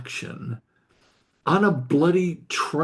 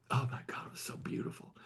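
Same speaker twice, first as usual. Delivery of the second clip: real hushed, whispered awe; whispered aside, overcome by the beauty; moved whisper; 13 dB quieter, faster than usual. Real, not acted. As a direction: drop to a whisper, deeply moved. Moved aside while retelling touching story.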